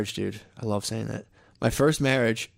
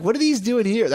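mock girlfriend question; 8 semitones higher, 3 dB louder than usual. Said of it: "She's like".

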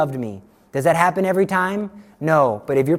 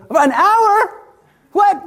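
high squeal of disbelief; an hour, are you kidding; aghast; 13 semitones higher, 4 dB louder, much slower than usual. Retold or imitated. Retreat newcomer told to kneel an hour, high squeaking disbelief.

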